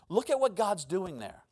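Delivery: ordinary talk, nothing marked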